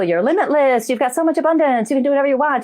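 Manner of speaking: high-pitched, rousing self-imitation; upbeat coaching. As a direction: pitch it up like an eager pep talk. Quotes her own upbeat teaching.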